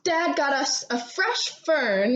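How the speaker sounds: ordinary delivery, nothing marked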